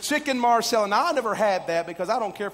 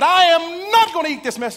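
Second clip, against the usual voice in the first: higher, louder indignant outburst; angry refusal; 8 semitones higher, 8 dB louder than usual. Retold or imitated. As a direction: shove the words out like the plate. Rude guest refuses the meal.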